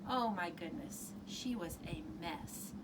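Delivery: ordinary delivery, nothing marked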